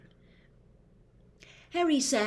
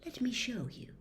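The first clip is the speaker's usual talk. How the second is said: hushed, beckoning; soft invitation; 5 semitones higher, 5 dB quieter than usual. Acted. Riddle softly tempts Harry into the memory. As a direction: lure him in gently.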